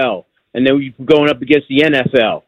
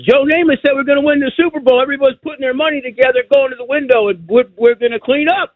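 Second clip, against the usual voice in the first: higher, fast, eager retelling of dad; excited dad; 9 semitones higher than usual. Retold or imitated. Caller voicing his father's thrill, higher and excited. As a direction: hype it like dad on a sure bet. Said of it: "He goes".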